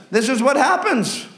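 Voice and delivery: ordinary talk, nothing marked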